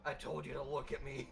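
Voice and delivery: dopey deep voice; deepest voice possible. Pretend. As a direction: drop as low as you can go. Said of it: "say in the deepest voice I can".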